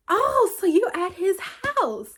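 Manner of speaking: high, loud, drawn-out gotcha; mock accusing. Reacting to the girl's story post, loud mock accusation. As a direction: call it out high and slow, like catching someone out.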